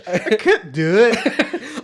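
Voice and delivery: slow, loud Scottish send-up, pitched high; mock Scottish. Co-host mimicking his friend's slipped accent, laughing mock Scottish. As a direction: stretch it out in a teasing Scottish accent. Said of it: "Dude, a little Scottish".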